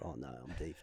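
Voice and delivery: low, very soft thought to yourself; retold sinking dread. Player watching microphone fly into crowd, quiet dread. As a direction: drop right down as trouble dawns.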